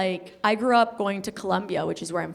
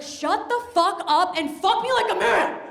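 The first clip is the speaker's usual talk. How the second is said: exasperated yell; 11 semitones higher, 4 dB louder than usual. Retold or imitated. Retelling her reply to a partner in bed, loud and high. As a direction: snap it at him high and loud. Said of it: "I was like".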